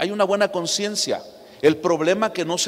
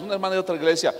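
ordinary talk, nothing marked

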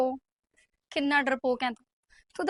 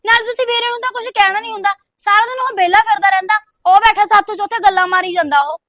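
much louder, exasperated complaining; loud protest; 4 semitones higher, 10 dB louder than usual. Acted. Daughter protesting to her mother about her brother, loud and exasperated. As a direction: fire back at mom, louder and louder.

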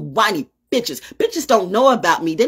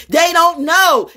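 emphatic; 11 semitones higher, 6 dB louder, slower than usual. Real, not acted. Rant about therapists.

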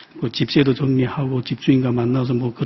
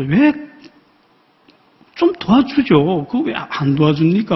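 plaintive prayer; 4 semitones higher than usual. Retold or imitated. Preacher retelling his hurt prayer to the Lord, plaintive and pleading. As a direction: ask God why, aching and hurt. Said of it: "하고 이렇게 기도를 하는데".

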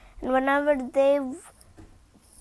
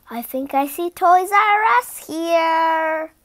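gasp, then higher and louder; excited discovery; 3 semitones higher, 7 dB louder than usual. Real, not acted. Reader spotting a familiar store in the picture book, excited. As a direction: gasp and point it out eagerly.

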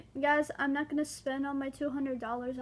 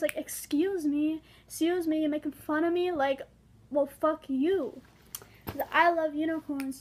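mock confrontation, louder with each line; defiant comeback; 3 semitones higher, 6 dB louder than usual. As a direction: put an imagined bully in their place. Imagined comeback to bullies.

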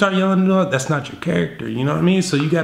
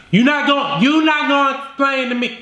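put-on rising girlfriend anger; 11 semitones higher than usual. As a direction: push harder, offended. Acted girlfriend escalating.

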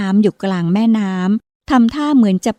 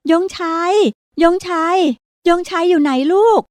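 desperate calling; 9 semitones higher than usual. Acted. Mother searching for drowning son.

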